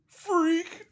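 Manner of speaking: very high, loud mock shriek; hammed-up squeal on one word; jock's panicked shout. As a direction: yelp it like a spooked bully. Acting jock who punches and flees.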